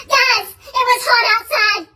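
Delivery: usual speaking voice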